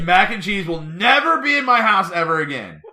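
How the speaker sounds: loud, high comic decree; mock ultimatum. Acted ultimatum in a quarrel.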